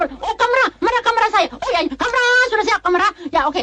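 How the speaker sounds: very high-pitched, hurried director's call; frantic calling out. Acted. Director calling for his camera crew, frantic.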